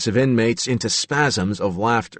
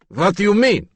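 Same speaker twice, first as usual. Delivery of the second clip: mock Yugoslavian accent, much higher and louder, growled; mocking Reagan's Yugoslavian accent; 12 semitones higher, 5 dB louder than usual. Steve taunting the others, loud mocking Yugoslavian accent. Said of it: "mocking Reagan's accent".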